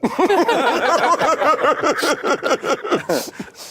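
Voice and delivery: very high, long laugh; real laughter. Real, not acted. Footballer laughing about signing a blank contract, high laugh.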